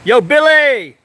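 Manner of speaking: long, high, loud call. Real, not acted. Calling the polar bear out of his cave, slow loud holler. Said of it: "trying different names".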